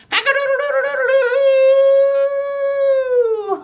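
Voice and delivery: high, loud, long-held crow; rooster crow. Pretend. Host plays farm song's rooster, crowing loudly. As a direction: crow like a rooster, holding the last note. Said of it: "he makes a sound like this".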